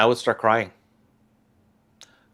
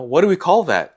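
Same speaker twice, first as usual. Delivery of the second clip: higher, louder mocking echo of the question; mocking repeat; 9 semitones higher, 5 dB louder than usual. Mocking the stakeholder's question, an incredulous higher echo. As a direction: parrot their words back in disbelief.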